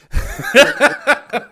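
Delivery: big, high burst of laughter; loud laugh. Real, not acted. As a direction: crack up loudly at the quote. Cracks up after quoting the line.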